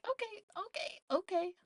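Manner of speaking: very high, thin, small okays; tense high-pitched repeated okay; inner panic. Retold or imitated. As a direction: squeak it as a retold silent self-reassurance. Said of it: "in my head".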